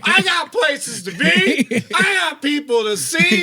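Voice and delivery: high, drawn-out mock shouting; hammed-up aggrieved middle-aged man yelling; boomer rant. Listener parodies the driver's boomer energy, shouting. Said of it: "that's such boomer energy".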